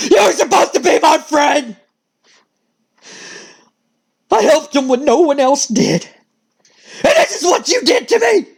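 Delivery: high, rasping outburst of betrayal; wounded rage. Acted. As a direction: rasp it out high at the friend who betrayed you. Acted snap into hurt rage.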